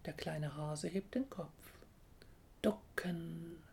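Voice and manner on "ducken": hushed, whispered warning; murmuring bush. A narrator's reading. Elder bush warns the little hare, murmuring. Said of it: "raunt der Holunderbusch".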